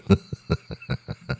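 real wheezy laugh ending in a snort. Real, not acted. Comedian losing it over his own story, snorting laugh. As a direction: laugh breathlessly until you snort. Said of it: "I snorted".

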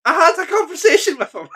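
high, laughing exclamation; real giddy delight. Real, not acted. Fan telling co-host about meeting a hero, real giddy delight. Thrilled at having met hero.

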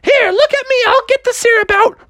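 high falsetto mother taking charge; high, urgent mom voice; Froggy's mom. Acted. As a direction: order it urgently in a squeaky voice. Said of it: "Froggy's mom was like".

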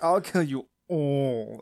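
slow, loud villain threat; Machine Dramon. Acted. Villain threatening to kill everyone, slow loud mock menace. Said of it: "Machine Dramon's like".

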